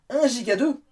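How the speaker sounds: pleasantly stunned outburst; amazed. Real, not acted. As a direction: exclaim it, astonished at the record. Surprised by record RAM figure.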